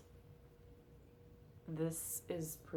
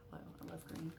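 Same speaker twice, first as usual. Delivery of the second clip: soft whispered aside; whispering; 10 dB quieter, much faster than usual. Real, not acted. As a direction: whisper it playfully.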